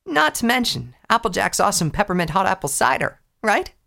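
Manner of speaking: put-on eager, boastful young pony; Rainbow Dash voice. Dash voice again.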